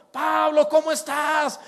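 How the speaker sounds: put-on loud, high, glad welcome to an old friend; excited greeting. Brothers greeting Pablo with a loud, glad welcome. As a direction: call out his name with joy.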